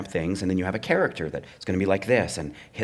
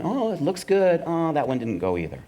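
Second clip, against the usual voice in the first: mock hope sinking; 8 semitones higher than usual. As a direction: start high and hopeful, drop into a let-down. Acts out hope, then failure.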